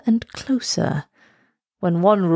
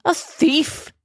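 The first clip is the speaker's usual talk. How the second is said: incredulous; 8 semitones higher than usual. Acted. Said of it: "spluttered the king".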